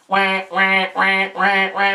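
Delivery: hammed-up baby-like wah wah cries; playful pretend crying sound; mock wailing. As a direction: fake a panicked wail for the kids. Panicometer wailing.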